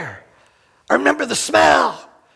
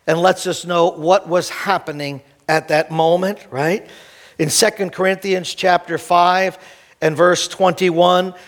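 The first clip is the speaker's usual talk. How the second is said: lower, calmer scripture teaching; 6 semitones lower than usual. Acted. Pastor teaching the congregation, calm and low. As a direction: explain it gently and low.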